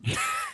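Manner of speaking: sudden squealing laugh; high burst of laughter. Real, not acted. Joke about yawning.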